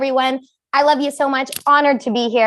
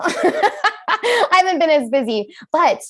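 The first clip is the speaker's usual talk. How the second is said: laughing; 7 semitones higher, slower than usual. Real, not acted. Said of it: "Can you tell?".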